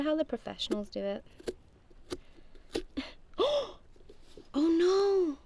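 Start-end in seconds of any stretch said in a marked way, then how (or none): 1.9-4.5 sudden high gasp of shock; startled gasp; sharp gasp. Real, not acted. 4.5-5.4 high, loud cry of dismay; loud alarmed oh no. Real, not acted.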